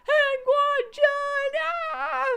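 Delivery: very high, very loud, slow drawn-out scream; ecstatic scream. Acted. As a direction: scream the name with wild joy. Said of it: "screamed even louder".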